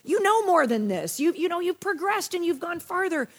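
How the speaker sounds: high-pitched exasperated pleading; exasperated Paul. Retold or imitated. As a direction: plead like a let-down teacher who expected better. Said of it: "Paul was just kinda exasperated".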